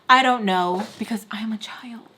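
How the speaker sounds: breathy aside whisper. Real, not acted. Whispered aside to viewers about being a child. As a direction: drop to a whisper for the joke.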